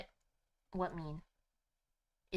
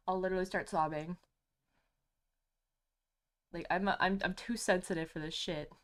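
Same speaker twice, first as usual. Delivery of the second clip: usual speaking voice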